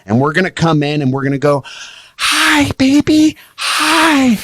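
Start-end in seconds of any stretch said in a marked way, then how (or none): 2.2-4.4 very high, gushing hello; excited greeting. Acted. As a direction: squeal hello to your dog after a long day. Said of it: "tone's gonna get really excited and high".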